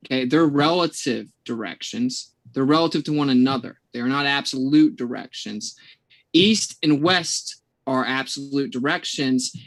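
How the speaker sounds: slow, deliberate explanation; really slow. Real, not acted. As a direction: spell it out slowly, phrase by phrase. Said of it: "I'm gonna say it really slow this time".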